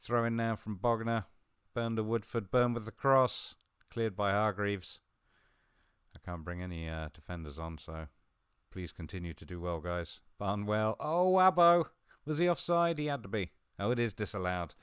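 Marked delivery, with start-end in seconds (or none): 0.0-5.0 loud, raised match commentary; live play-by-play. Real, not acted. Gamer calls a throw-in and cross, loud commentary. 10.4-14.7 loud, high burst, then easing down; excited play-by-play. Real, not acted. Gamer sees a goal disallowed, excited commentary sinking back.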